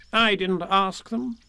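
high, slow offhand rabbit; carelessly dismissive character voice. A narrator's reading. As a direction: shrug it off airily. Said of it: "explained Rabbit carelessly".

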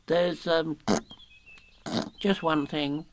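hesitant small-animal voice, a bit high; fidgety. A narrator's reading. Piglet raising a doubt with Rabbit, nervously. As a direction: falter uneasily into the objection. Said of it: "fidgeting a bit".

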